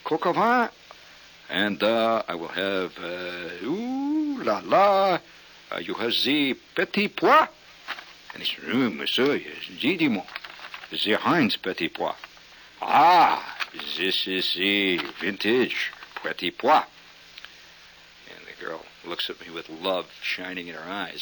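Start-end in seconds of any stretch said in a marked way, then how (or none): 0.0-7.5 hammed-up French accent; mock French accent. Ordering at fancy French restaurant. 8.6-16.8 put-on slow, stretched French accent; mock French waiter accent. Acting French waiter presenting the peas.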